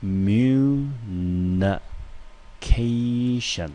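slow syllable-split communication; drawn-out syllables. Shown as a demonstration. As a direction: stretch communication out so every syllable is heard. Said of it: "我们音节先试着分分看".

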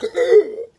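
loud mock gasp; exaggerated intake of breath; shocked gasp. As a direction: gasp sharply at the price.

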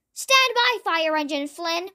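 ordinary delivery, nothing marked

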